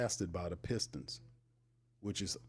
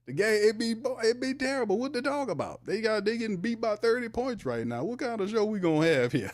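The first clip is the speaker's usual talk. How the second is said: high, loud, squeaky disbelief; venting about the team getting beaten badly, voice pitched up; incredulous rant; 12 semitones higher, 6 dB louder than usual. Real, not acted. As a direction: rant at the blowout in a strained high voice. Exasperated rant about losing team.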